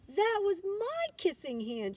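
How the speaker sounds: put-on high, slow, heartbroken child; saddest voice. A narrator's reading. Chester sees his kiss given away, heartbroken. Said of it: "in the saddest voice his mother had ever heard".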